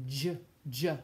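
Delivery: j said "juh", an English J; soft G as J. Shown as a demonstration. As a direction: isolate j as a short "juh". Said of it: "like the letter J in English".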